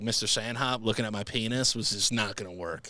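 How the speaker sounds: normal talking voice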